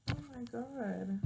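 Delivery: ordinary talk, nothing marked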